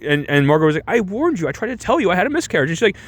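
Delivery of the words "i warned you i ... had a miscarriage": high-pitched, hurried accusation; mock reproach. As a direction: plead your case in a mildly hammed-up voice. Acted quarrel, Margot accusing Nellie.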